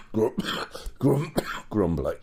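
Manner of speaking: ordinary talk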